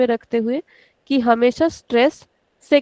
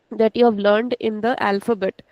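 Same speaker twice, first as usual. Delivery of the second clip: ordinary delivery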